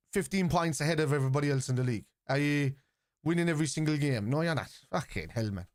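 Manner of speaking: ordinary talk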